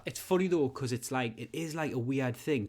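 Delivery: ordinary talk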